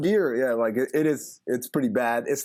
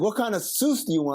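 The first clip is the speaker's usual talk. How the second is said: imitating a Baltimore cashier, sauce said like sus, pitched higher; mock Baltimore accent; 7 semitones higher than usual. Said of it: "She was trying to say sauce".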